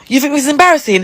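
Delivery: high, sharp comeback; scornful comeback to his complaint; indignant retort. Retold or imitated. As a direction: throw his word back at him. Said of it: "I was like".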